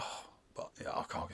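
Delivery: faint, breathy croak of a fake invalid; fake sick voice. Worker phoning the boss for a sickie, fake sick voice. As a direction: sound too ill to come in. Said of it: "You'd have to put on the voice".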